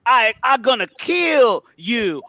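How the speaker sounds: ordinary delivery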